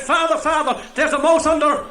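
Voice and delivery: high, loud mock panic; panicked. Housekeeper bursting into the study, mock panic. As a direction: burst in alarmed about a mouse.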